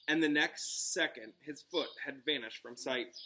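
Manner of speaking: plain reading voice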